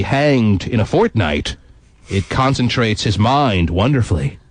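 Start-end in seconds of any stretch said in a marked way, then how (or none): none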